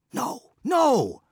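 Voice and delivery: very high, loud desperate cry; cried out. Acted. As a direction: cry out in refusal. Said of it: "cried Mabon".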